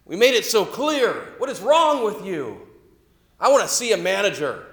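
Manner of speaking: loud, slow, high furious dad shouting; furious yelling. Retold or imitated. Angry dad yelling at the waitress over tomatoes, furious shouting. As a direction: yell at the waitress like an enraged father. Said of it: "Starts getting furious and shaking his fist".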